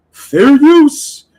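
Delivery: high, loud nasal-free squeal of a whiny streamer; mocking impression. Streamer mimicking a banned rival's catchphrase, high and loud. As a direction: shriek it like a caricature. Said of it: "what he used to say besides fair use".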